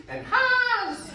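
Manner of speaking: high whooping laugh; imitated whoop. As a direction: whoop it out high and loud like a band. Imitating banjo band whoops.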